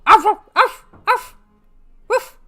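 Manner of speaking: loud high yappy arfs; dog barking. Shown as a demonstration. Owner yapping at her cat, loud and high. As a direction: yap like a small dog. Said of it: "then I started barking".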